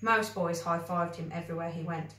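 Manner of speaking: plain reading voice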